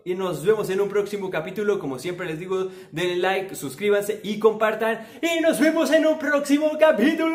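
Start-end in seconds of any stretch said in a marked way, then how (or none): 5.2-7.4 high-pitched, exuberant farewell; high, playful announcer-like send-off; showy sign-off. Pretend. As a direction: sing out the goodbye high and excited.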